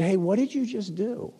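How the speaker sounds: high, light questioning lilt; imitated gentle prompt, pitched well up; Holy Spirit/character voice. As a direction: ask it high, like a leading question. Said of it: "He said".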